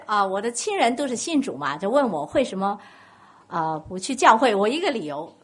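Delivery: ordinary talk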